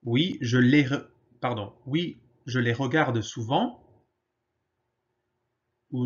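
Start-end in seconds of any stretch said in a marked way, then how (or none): none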